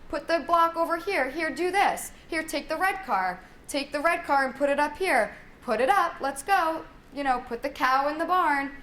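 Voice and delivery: put-on directing a child's play, one order after another; bossy play commands. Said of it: "giving them a lot of commands".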